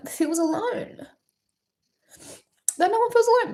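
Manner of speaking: real tearful crack; voice breaking. Real, not acted. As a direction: pitch cracks high on feels alone. Voice breaks while crying.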